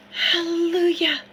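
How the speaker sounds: ordinary delivery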